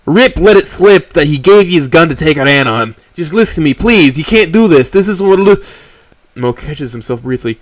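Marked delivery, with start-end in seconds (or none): 0.1-5.6 high, loud, urgent pleading; frantic and worried. Acted. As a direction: grab her attention in a panic.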